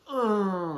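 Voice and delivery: high-starting drawn-out ugh; cringing groan. Acted. Watching a friend fail with a girl, acted cringing groan. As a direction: groan in secondhand embarrassment. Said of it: "and you're like".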